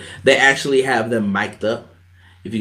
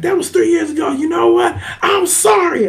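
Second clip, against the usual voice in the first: much higher, pleading falsetto; mock tearful apology. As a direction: ham up a choked-up apology. Seth's apology, acted out tearfully.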